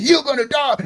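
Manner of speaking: high, softened mock threat; pretend yell. Harasser threatening in your face, high mock yell. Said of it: "and I'm yelling in your face".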